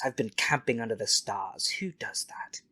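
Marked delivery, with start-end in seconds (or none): 1.8-2.6 snobby aside whispered. Acted. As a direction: hiss it like a scandal. Rich character sneers at camping.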